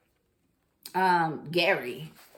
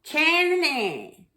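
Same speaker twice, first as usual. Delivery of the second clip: sing-song name; 7 semitones higher than usual. Shown as a demonstration. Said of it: "I don't know why I'm like that".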